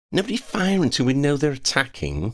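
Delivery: high-pitched call to the group. A narrator's reading. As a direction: call out a warning, pitched well up. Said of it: "he called out".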